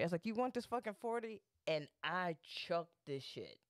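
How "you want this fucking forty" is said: higher-pitched retold taunt; taunting threat. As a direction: dare him before throwing it. Acted threat before throwing the bottle.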